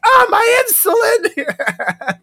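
high, drawn-out mock wail, laughing through it; mock panic. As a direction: ham up a panicked cry for the insulin, laughing. Mock patient panicking over medicine.

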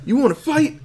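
high-pitched playful taunt; mock challenge. Podcast host voices Jean Jacket spoiling for a fight, mock challenge. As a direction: goad them, pitch leaping up.

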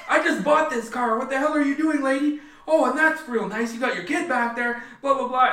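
high, loud irate rant; angry driver. Retold or imitated. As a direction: imitate a driver yelling at whoever hit the car. Acts out angry driver after crash.

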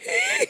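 imitated squeaky, very high laugh; high-pitched laugh. Co-host imitating Ronnie's laugh for friends, high-pitched laugh. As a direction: mimic a bodybuilder's shrill high giggle. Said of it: "he's got that high-pitched laugh".

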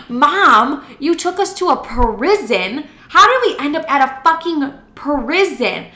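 high, loud retold disbelief; exasperated outburst. Daughter confronting mom about landing at a prison, exasperated outburst.

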